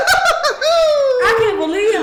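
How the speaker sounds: slow, high, laughing; laughing disbelief. Real, not acted. Remembering the boyfriend's antics, laughing disbelief. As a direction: laugh it out slowly.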